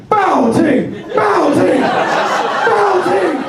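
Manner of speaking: loud, very high-pitched panicked cry; yelling for help. Retold or imitated. Panicking comedian calling out for help, high-pitched yell. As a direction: holler for rescue at the top of your range. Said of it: "yelling that time for help".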